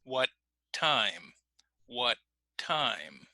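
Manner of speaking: WHAT held on the T, short gap, released into TIME; stop, then finish. Shown as a demonstration. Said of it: "we would finish on the next word".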